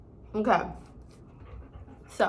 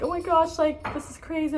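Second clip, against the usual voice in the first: high-pitched reenacted gushing; excited amazement; 8 semitones higher than usual. Teller gushing to best friends on arrival, excitedly reenacted.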